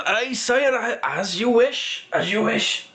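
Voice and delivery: high-pitched, deferential dwarf merchant; obliging old dwarf. Acted. Dwarf gives in to the guard's order, high and deferential. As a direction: yield eagerly in a high character voice. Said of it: "He goes".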